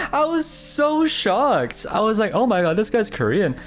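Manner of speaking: high squeal through laughter, slowing on "so shocked"; laughing shock. Real, not acted. Streamer recalls learning he's Korean, laughing shock.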